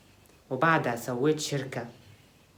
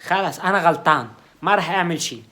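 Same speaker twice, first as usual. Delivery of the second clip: offended outburst; 2 semitones higher, 6 dB louder than usual. Acted. Offended roommate storms off.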